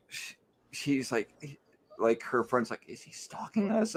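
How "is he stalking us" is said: high, soft, furtive question; hushed friend. Retold or imitated. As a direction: ask it secretly, imitating a nervous friend. Voicing friend whispering about actor.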